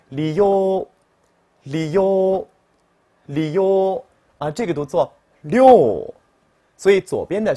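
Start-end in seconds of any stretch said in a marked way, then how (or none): 0.1-4.1 "哩呦" as two separate beats; split syllables. Shown as a demonstration. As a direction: say "哩呦" slowly, li then yo apart. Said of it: "读作哩和呦分开".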